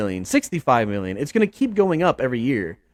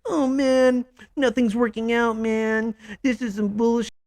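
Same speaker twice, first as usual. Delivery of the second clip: higher, whiny mock sulk, trailing softer; pouting quarterback; 7 semitones higher, 3 dB quieter than usual. Mocking Kyler losing on the sideline, whiny high pout. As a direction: imitate a sulky star complaining on the bench. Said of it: "Does he pout on the sideline".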